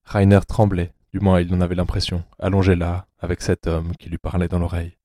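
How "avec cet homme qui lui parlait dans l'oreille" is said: ordinary delivery, nothing marked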